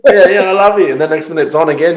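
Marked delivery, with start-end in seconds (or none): 0.1-0.9 higher and louder gushing reconciliation; hammed-up instant make-up; mock making up. Acting out the couple making up, higher and louder in mock gush.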